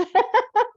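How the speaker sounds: a sudden, high, loud giggle; high burst of laughter. Real, not acted. Teacher laughing at her own Cockney accent joke to her theater friends, high loud giggle.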